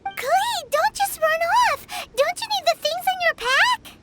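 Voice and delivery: put-on a companion calling after a girl who runs off, high-pitched; calling after her. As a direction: call out to stop her leaving. Calling after a child running off.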